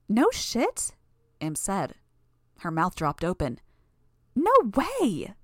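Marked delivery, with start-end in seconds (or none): 0.1-0.9 higher, stunned disbelief; surprised. A narrator's reading. Imp astonished at the reveal. 4.4-5.3 high, loud astonished cry; shocked. A narrator's reading. Imp's mouth drops open.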